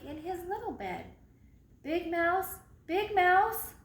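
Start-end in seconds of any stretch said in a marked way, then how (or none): 1.8-3.7 calling loudly across the dark room. Acted. Little mouse calling loudly to his sleeping brother. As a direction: raise the voice to wake him. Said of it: "called Little Mouse".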